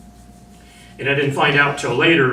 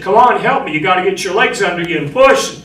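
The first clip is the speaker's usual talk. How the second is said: high, loud urgent yell; 7 semitones higher, 7 dB louder than usual. Retold or imitated. Marine yelling at trapped sailor to push free, loud and high. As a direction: yell at him to push with his good leg. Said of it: "I'm yelling at him".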